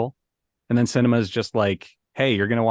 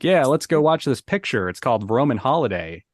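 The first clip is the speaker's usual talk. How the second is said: put-on higher old-Hollywood transatlantic accent; 3 semitones higher than usual. Shown as a demonstration. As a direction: announce it like a 1950s star in transatlantic accent. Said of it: "when I'm doing a transatlantic accent".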